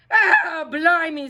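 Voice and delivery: loud, high exasperated shout; pirate exclamation. A narrator's reading. Captain Pete fed up with the rascal, loud and high. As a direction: throw up your hands and bellow. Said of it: "said Pete".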